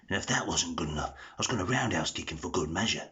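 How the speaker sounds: gruff, breathless Cockney hard man, softly spoken; Jason Statham impression. Tutorial maker putting all three together, gruff breathless Cockney. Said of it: "you put all three together".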